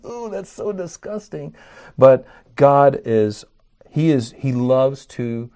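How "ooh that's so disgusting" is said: high, soft squeamish "ooh" from the ladies; mock disgusted women. Preacher mimics the women hearing of Goliath's beheading, high and soft disgust. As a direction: play the women in the pews recoiling at the gore. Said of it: "all the women are going".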